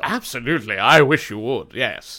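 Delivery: posh wealthy gentleman; rich guy voice. Pretend. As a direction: play a rich snob. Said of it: "oh, hey, I did a voice".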